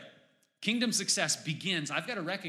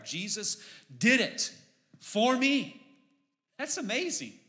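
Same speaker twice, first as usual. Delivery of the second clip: joyful exclaim; 8 semitones higher, 4 dB louder than usual. Real, not acted. Preacher's climax of joy.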